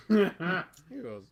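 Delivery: ordinary delivery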